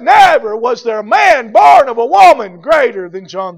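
loud, emphatic pulpit proclamation; full-force preaching. Acted. Preacher proclaiming Christ's praise of John the Baptist, full-force preaching. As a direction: punch out each key word loudly.

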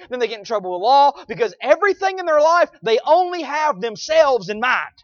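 loud high preacher delivery; preaching at full force. Real, not acted.

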